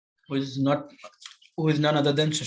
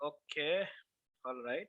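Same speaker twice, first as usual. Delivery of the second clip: faint, distant mic sound; barely audible; 13 dB quieter, slower than usual. Real, not acted. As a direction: voice far too low on the mic. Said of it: "It's very low".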